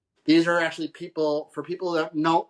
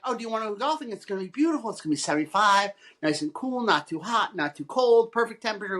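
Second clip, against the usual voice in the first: lightly raised, persuading voice; coaxing friend; 6 semitones higher than usual. Retold or imitated. Friend tempts her to go golfing, lightly raised and coaxing. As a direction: lightly voice a friend selling a golf day. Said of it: "my friend's like".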